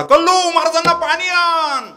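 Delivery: high, loud call to the kitchen. Retold or imitated. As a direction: call out to the wife inside. Host calling into the kitchen. (स्वयंपाकघरात जोरात हाक)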